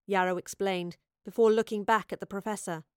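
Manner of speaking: ordinary delivery, nothing marked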